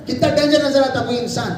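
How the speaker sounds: usual speaking voice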